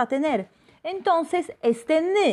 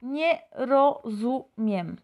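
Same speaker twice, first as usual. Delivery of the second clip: slow, syllable by syllable, nie rozumiem; slow Polish demo; much slower than usual. Shown as a demonstration. Teacher modelling the phrase slowly for Spanish speakers. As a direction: spell out "Nie rozumiem" slowly, each sound clear. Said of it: "votamos por la segunda frase".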